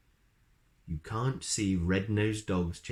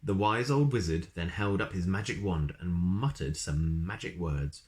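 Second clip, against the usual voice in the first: ordinary delivery, nothing marked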